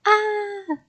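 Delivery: very high mock screams; scariest scream. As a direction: scream like a scary clown. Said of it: "I did my scariest scream".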